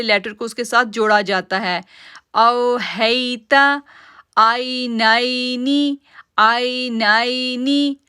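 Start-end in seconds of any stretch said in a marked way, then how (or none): 2.3-8.0 "आई नाइनी" slow, "आई" and "नाइ" gliding soft; soft joined vowel. Shown as a demonstration. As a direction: join the letter to the ya softly. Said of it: "आइन को या के साथ जोड़ा". (नरमी से जोड़कर "नाइनी")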